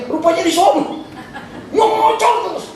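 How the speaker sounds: high-pitched comic exasperation; mock nagging. Imitating the wife's endless scolding.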